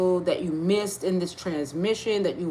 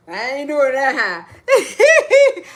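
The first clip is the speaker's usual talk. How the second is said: laughing; 3 semitones higher, 6 dB louder, much slower than usual. Real, not acted. Laughing at failed high note.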